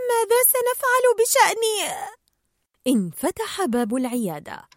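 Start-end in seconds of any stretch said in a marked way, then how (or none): 0.1-1.6 high, panicky little voice; Nemo, panicked. A narrator's reading. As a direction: ask it in alarm, pitched way up. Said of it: "فهلع نيمو قائلا".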